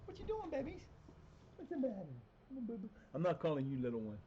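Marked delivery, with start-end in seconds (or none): 0.0-2.9 high, sing-song pet voice. Real, not acted. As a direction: coax the cats in a high cooing voice. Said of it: "I was talking to my cat".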